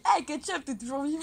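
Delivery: loud high cartoon cry; shouted tomato line. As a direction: yell it like a panicked tomato. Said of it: "Elle dit".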